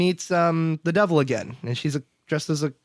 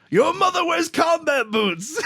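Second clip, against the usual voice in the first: high, drawn-out taunting holler; comic high-pitched shout; mock yell; 13 semitones higher, slower than usual. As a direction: yell a hammed-up insult across at enemies. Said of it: "he yells at the Russians".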